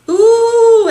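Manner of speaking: loud, high-pitched ooh; excited. Real, not acted. Seeing a new bronzer, excited. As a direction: squeal it with delight.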